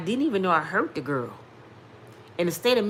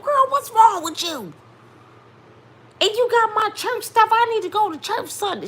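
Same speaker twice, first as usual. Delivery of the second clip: loud, very high scolding mama impression; mother going off; 7 dB louder than usual. Awakened mother scolding her screaming daughter, loud and high-pitched. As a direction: fuss shrilly at your daughter at full tilt. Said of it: "She going off".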